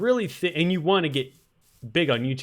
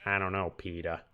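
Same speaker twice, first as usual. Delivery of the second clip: lower, softer nasal impression; nasally cartoon voice; 5 semitones lower, 7 dB quieter than usual. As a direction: imitate a nasal Family Guy neighbour. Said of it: "Kinda talks like".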